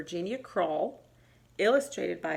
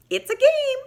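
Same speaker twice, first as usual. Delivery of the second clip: high, squeaky little voice; squeaky mouse; 8 dB louder than usual. Acted. Mouse squeaking to Bear about the game. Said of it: "squeaked Mouse".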